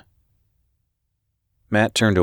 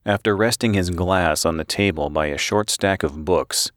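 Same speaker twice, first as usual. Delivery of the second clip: ordinary delivery, nothing marked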